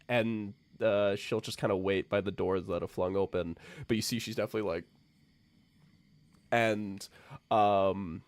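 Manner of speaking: normal talking voice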